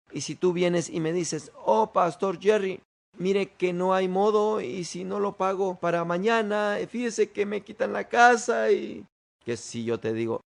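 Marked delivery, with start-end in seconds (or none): 1.6-9.0 worried plea. Acted. Worried church member begs the pastor over losing the house, pleading.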